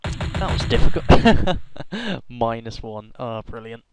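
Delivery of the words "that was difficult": loud breathy laughing line; much louder laughing remark; laughing relief. Real, not acted.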